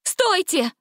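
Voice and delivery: high urgent cry; high shouted stop. A narrator's reading. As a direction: stop them at once. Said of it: "крикнула Гретель".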